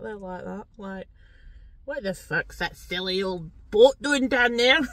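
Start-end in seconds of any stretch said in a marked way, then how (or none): 1.8-4.8 put-on loud, slow comic Scottish accent; mock Scottish accent. Imagined Glasgow viewer watching her, mock Scottish accent. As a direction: heckle in broad Scottish, drawn out. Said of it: "talking bloody Scottish".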